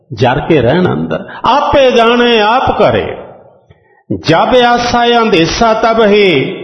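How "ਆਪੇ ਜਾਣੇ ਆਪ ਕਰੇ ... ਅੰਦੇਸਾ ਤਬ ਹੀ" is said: slow, drawn-out recitation of scripture; gurbani recitation. Acted. Preacher reciting gurbani to the congregation, slow and devout. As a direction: intone each phrase slowly and devoutly.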